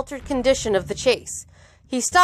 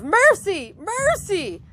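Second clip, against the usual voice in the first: high acted cry for mercy; restrained narrator's scream; wild scream; 9 semitones higher, slower than usual. A narrator's reading. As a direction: plead in a high, falling wail. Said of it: "a wild scream of".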